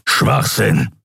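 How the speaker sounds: lightly growled dismissal; a touch of a growl. A narrator's reading. Keru dismisses Kai's claim, mild growl. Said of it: "grollte Keru".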